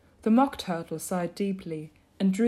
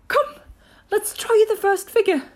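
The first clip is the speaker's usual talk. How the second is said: high, loud creature voice; eager high turtle voice; Mock Turtle; 14 semitones higher, 8 dB louder than usual. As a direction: urge them on, high and loud. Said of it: "said the Mock Turtle to the Griffin".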